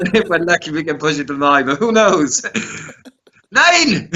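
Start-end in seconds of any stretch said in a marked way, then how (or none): 3.5-4.1 mock exclamation. Joking exclamation of the count.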